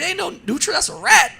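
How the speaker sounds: high, scoffing rebuttal, rising loud at the end; mock indignant retort. Telling the in-laws their nutra is a rat, incredulous and high. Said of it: "I'm like".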